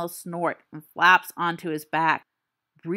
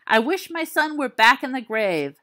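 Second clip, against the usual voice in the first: crying out; 6 semitones higher, 4 dB louder than usual. Acted. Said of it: "he cried out".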